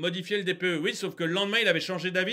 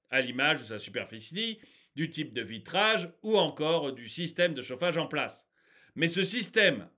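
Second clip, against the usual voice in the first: report read-out; 5 semitones lower than usual. Real, not acted. Ranter reads the report to viewers, composed reading.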